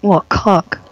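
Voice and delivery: posh English accent. Real, not acted. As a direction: say it like a posh Brit. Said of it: "You sound like a posh person".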